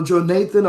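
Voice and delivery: Jonathan said joe-NAY-thun, long A in the middle; alternate pronunciation. Shown as a demonstration. Article reader offering another way to say the name, Jonathan as joe-NAY-thun.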